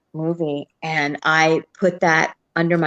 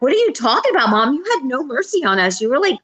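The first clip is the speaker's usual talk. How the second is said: high, incredulous protest; imitating indignant kids; 8 semitones higher, 3 dB louder, faster than usual. Grown kids reproaching their mom, indignant. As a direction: argue back at Mom, pitched up.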